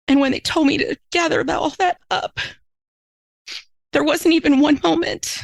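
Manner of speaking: holding back tears as she recalls gathering the drugs; crying while telling. Real, not acted. Tearful high voice remembering her grief. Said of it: "I've ever cried this much on a podcast".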